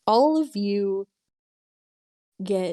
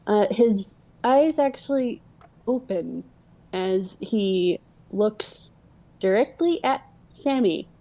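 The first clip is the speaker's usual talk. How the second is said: normal talking voice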